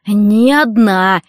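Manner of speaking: very slow, indignant emphasis; drawn-out, spiteful insistence; angry rasp. A narrator's reading. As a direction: stretch each word in anger. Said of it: "прохрипел он зло".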